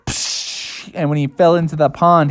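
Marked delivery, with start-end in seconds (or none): 0.1-0.9 whispery "psh" of a big splash; soft, voiceless splash sound; splash noise. Acted. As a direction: imitate water spraying with breath only. Imitated cannonball splash.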